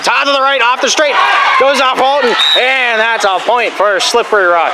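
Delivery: high-pitched, excited rally call; sports commentator in full flow; live play-by-play. Real, not acted. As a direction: follow every touch with mounting excitement.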